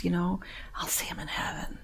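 hushed, unvoiced hope; whispered prayer. Real, not acted. Praying for the dead resident, whispered hope. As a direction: whisper it to the Lord.

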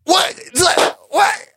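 very high-pitched excited amazement; excited disbelief. Real, not acted. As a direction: burst out high, stunned by the news. Amazed at the big mutant news.

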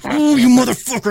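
angry cursing. Retold or imitated. Man retelling his fight with a weed eater, angry cursing. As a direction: curse out the machine at high pitch. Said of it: "I'm, like, cursing at it".

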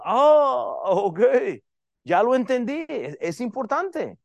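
high, loud put-on "oh ok, I get it"; a hammed-up aha moment; mock convinced listener. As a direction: play the congregant who suddenly understands. Mock listener finally getting it.

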